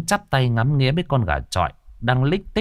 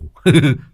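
cackling laugh; 6 semitones higher, 7 dB louder than usual. A narrator's reading. Youth laughs at crash.

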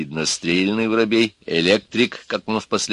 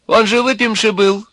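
high, louder arguing man's falsetto; womanish voice; 13 semitones higher, 6 dB louder than usual. A narrator's reading. Snub-nosed man argues with the wizened one, womanish voice. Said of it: "заспорил с сухоньким некто курносый с женским голосом".

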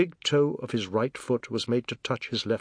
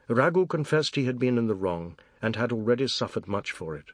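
plain reading voice

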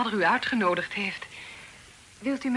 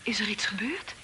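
whisper; 3 dB quieter than usual. Acted. Daughter whispers to the guest.

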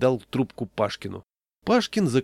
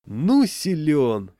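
slow, loud admiration; squealing delight; 5 semitones higher, 7 dB louder, much slower than usual. A narrator's reading. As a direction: draw it out, higher and louder. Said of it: "повизгивал Яшкин". (восторженно, нараспев)

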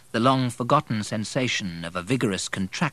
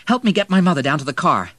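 loud, higher, hurried appeal; urgent request; 8 semitones higher, 9 dB louder, faster than usual. A narrator's reading. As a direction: enlist help in a hurry.

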